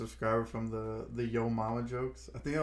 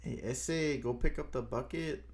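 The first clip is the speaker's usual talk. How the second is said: lightly put-on Mexican kitchen-worker talk; amateur Mexican accent; 2 semitones higher, 4 dB quieter than usual. Shown as a demonstration. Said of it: "my Mexican accent comes from".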